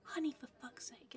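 hushed, urgent aside under the cover talk; sweary exasperated whisper; secret whisper. Acted. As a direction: whisper so the watchers can't hear. Spy's hushed aside to partner.

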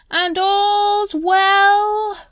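high, loud, slow town crier call; bellowing. A narrator's reading. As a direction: bellow it across the street. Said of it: "he bellowed".

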